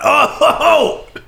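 high gasping from hot sauce; burning gasps. Real, not acted. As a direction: yelp at the spicy heat. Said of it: "Oh, that one's hot".

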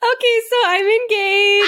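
high, laughing, almost squealing; laughing excitedly. Real, not acted. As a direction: blurt it out through laughter. Announcing engagement, laughing.

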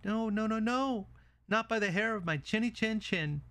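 high-pitched little pig voice; defiant little pig. Acted. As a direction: squeal out the refusal as a tiny pig. Pig refuses the wolf.

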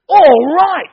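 very loud, high whoop of All right; ecstatic shout. Retold or imitated. Adam greeting Eve, ecstatic loud high whoop. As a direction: let it burst out of you. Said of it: "He goes like this".

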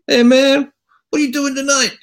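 put-on high, loud Italian accent; Antonio's Italian voice. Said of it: "So Antonio said to me".

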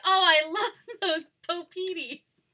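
high, slow, loud, laughing; friend voice. Retold or imitated. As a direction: drawl it out, laughing. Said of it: "And he was like".

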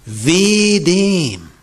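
a weighty prophetic cry; solemn woe. Retold or imitated. Jesus warning the traitor.

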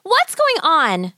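put-on a child asking what's happening; little girl Gretel. Frightened girl questions old woman.

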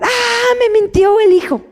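high, startled cry of a parent who caught a lie; mock alarm. Parent discovering child lied, mock alarmed. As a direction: gasp it out as if shocked.

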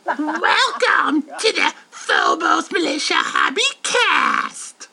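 high, slow sneering doll; creepy doll voice. Shown as a demonstration. Doing a mean doll intro for friends. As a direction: drag it out high and nasty. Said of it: "the voice of the little doll".

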